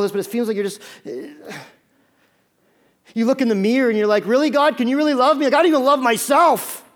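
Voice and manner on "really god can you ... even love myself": loud, high disbelieving plea; incredulous pleading. Acted. Doubter at the mirror questioning God's love, incredulous pleading. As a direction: challenge God in raised, anguished disbelief.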